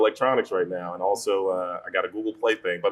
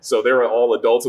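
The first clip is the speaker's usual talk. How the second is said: ordinary talk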